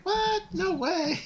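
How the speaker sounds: very high, squeaky mock disbelief; high squeaky impression. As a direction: squeal it like a shocked young woman. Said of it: "She's like".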